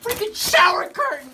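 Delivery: high exasperated cry; annoyed yelp; frustrated outburst. Real, not acted. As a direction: snap at the curtains. Frustrated outburst while hurt.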